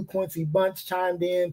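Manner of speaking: ordinary talk, nothing marked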